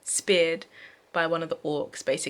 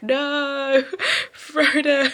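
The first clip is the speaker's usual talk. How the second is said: higher and louder fake cry, laughing through it; mock wail laughing; 7 semitones higher, 5 dB louder, much slower than usual. Vlogger mocking the missing mourning for Frodo, laughing. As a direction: ham up the grief while cracking up. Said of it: "No one was like".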